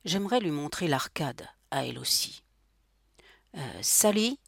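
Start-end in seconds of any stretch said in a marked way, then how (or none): 3.6-4.4 helpless call for his wife, raised pitch; higher, hesitant helpless call. A narrator's reading. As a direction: call out to her, out of your depth. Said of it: "appela Maurice, impuissant".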